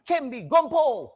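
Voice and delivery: high, loud, drawn-out spell ending; slow down and blast the last words; forceful incantation climax. Shown as a demonstration. Teacher striking with the charm, forceful incantation climax. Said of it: "Dekat gompo tu".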